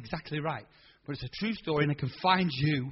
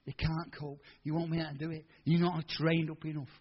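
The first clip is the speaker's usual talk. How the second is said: voice of the world; 2 semitones lower, 7 dB quieter than usual. Retold or imitated. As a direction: murmur doubt like the world. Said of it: "the voice of the world".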